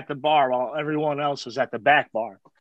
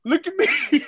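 much higher, exaggerated mimic wail; hammed-up plea for pity, breaking into laughter; mocking guilt-tripper; 11 semitones higher, slower than usual. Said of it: "just guilt trip you".

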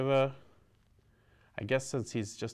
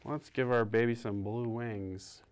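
ordinary delivery

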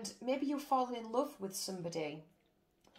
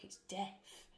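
spooky whisper; 7 dB quieter than usual. Acted. Scary death card.

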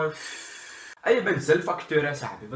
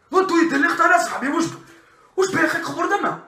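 loud Moroccan-Dutch street outburst; angry Moroccan Dutch shout; 10 semitones higher, 7 dB louder than usual. Acted. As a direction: yell at partner for the light. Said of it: "De Marokkanen". (boos roepen in straattaal)

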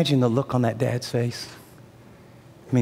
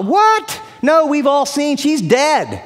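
high, loud incredulous outburst; mocking mourners; 12 semitones higher, 5 dB louder, slower than usual. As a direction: scoff at him as the whole crowd. Mourners mock Jesus.

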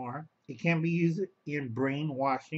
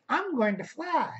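squeaky mock voice, much higher; high silly voice; 11 semitones higher than usual. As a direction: pipe it up like a fool. Mock quote of a deluded person.

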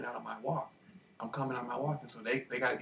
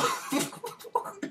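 high breathy laugh. Real, not acted. Laughing at the man sent in to watch. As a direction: burst of high airless laughter.

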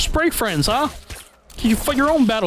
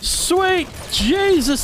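slow, high, stretched-out disbelief; drawn-out exclamation; 7 semitones higher, much slower than usual. Real, not acted. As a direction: moan it out in awe. Overwhelmed by maggots.

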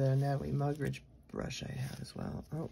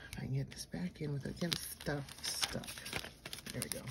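whisper; 6 dB quieter than usual. Real, not acted. Concentrating while packing items back.

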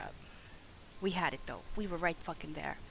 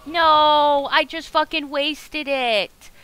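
much louder and higher, a real angry yell; furious shout; 9 semitones higher, 14 dB louder, much slower than usual. Real, not acted. Streamer screaming after wasting a flask. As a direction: blow up at your own mistake.

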